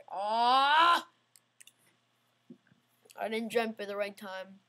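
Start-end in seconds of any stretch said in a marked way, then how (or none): 0.1-1.0 retold yelp. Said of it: "I'm like".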